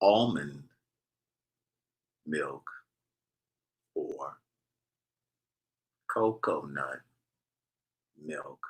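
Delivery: teasing slow-motion recipe read, whispery and low; exaggerated slow dictation. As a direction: drag each word out with long pauses. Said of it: "I'm gonna say it really slowly".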